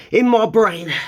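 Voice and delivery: ordinary talk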